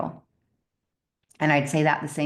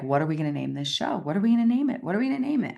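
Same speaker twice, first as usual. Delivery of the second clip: building from quick to much higher and desperate; frantic repeated asking; 5 semitones higher, much faster than usual. Retold or imitated. Stuck on a show name, asking herself in rising panic.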